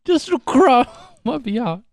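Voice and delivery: begging beggar voice. Acted. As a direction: plead high like a starving orphan. Repeats the beggar plea.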